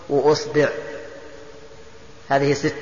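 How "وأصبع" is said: "وأصبع" said as wa-usbi', u then i; opening u, middle i. Shown as a demonstration. Teacher lists variants for students, "وأصبع" as wa-usbi'. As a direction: say "وأصبع" with damma on hamza, kasra on ba. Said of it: "ضم الهمزة".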